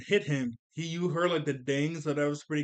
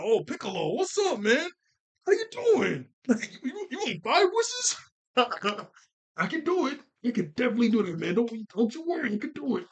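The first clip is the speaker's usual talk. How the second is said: mock eager dragon; 7 semitones higher than usual. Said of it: "They're like".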